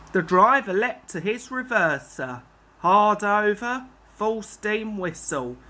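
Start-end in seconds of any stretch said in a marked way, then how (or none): none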